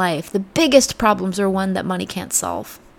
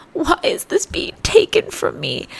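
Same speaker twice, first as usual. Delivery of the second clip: much higher, whiny "Why is this being taken from me?"; self-pitying take; 9 semitones higher than usual. Shown as a demonstration. Showing how we complain about a loss, high and whiny. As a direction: whine "taken" like a victim. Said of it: "Gentle inflection reframing".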